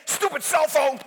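mock irritated grumble at a phone; hammed-up frustration; annoyed. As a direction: act fed up with the gadget. Acting out anger at phone.

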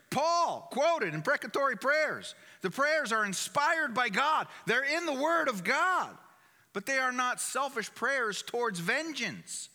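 slow, loud, high-pitched preaching; fiery preaching. Acted. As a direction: hammer each phrase home to the flock. Preaching at full force.

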